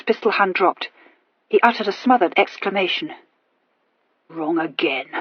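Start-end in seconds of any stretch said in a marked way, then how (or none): 4.3-5.1 disgusted growl. A narrator's reading. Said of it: "growled Mister Hawkins disgustedly".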